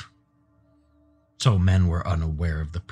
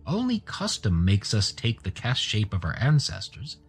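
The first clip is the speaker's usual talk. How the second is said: high-pitched, mildly sorrowful pleading; sad; 5 semitones higher than usual. Acted. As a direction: reason with them sadly, pitched up. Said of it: "Gur said sadly".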